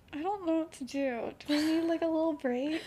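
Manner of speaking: high, upset, wavering. Real, not acted. Teller overwhelmed by her own story, shaken voice. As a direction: ask for a pause close to tears.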